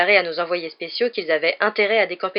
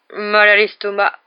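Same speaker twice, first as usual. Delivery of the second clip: slow, loud groan of a bellyache; grunted reply; 3 semitones higher, 8 dB louder, slower than usual. A narrator's reading. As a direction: grunt it out slowly. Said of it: "grogna Ron".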